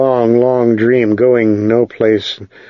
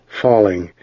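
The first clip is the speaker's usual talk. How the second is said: ordinary delivery, nothing marked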